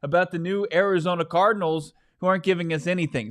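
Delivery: high-pitched indignant plea; mock protest. Fan defending himself to those saying relax, mock protest. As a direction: plead your case with exaggerated hurt. Acts out his protest to critics.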